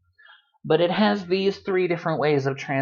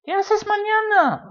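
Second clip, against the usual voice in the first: asking a friend. Acted. Acted mini dialogue, asking friend.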